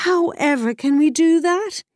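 high, loud despairing cry. Acted. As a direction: cry out in dismay. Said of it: "he cried to the mare".

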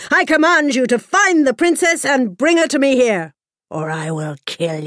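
commanding king. Acted. King commands and threatens boy.

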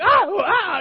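high, loud mock squeals; imitated excited oohs and aahs; Rapunzel squeals. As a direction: squeal "ah, ooh" in giddy bursts. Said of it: "it sounded like Rapunzel".

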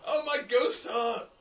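high, loud, slow anguished cry; dramatic mock plea. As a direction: overact a dying lament.

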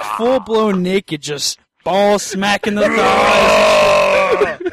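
loud and drawn out, building to a shout; loud slow telling. Retold or imitated. Telling friends about the woods, a hammed-up loud shout. Wild retold sex scene.

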